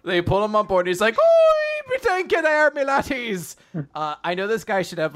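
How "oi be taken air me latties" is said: hammed-up Irish sailor squeal; Irish sea captain. Rescued captain greets the crew pulling him aboard, hammed-up Irish. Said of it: "a stereotypical Irish guy".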